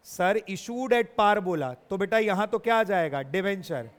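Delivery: ordinary talk, nothing marked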